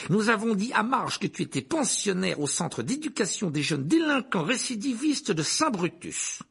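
high, emphatic proclamation; domineering uncle. Acted. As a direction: announce it imperiously.

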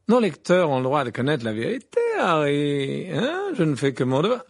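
loud, self-righteous reporter; affected gossip journalist. Acted. Rita defends her article to Harry, self-righteous. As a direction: justify yourself with theatrical self-importance.

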